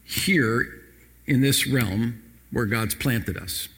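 ordinary delivery

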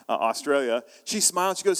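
usual speaking voice

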